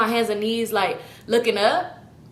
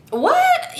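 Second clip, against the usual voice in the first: much higher, louder outraged what; shocked what; 4 dB louder than usual. Real, not acted. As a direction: yelp it in disbelief. Outraged disbelief at the eulogy.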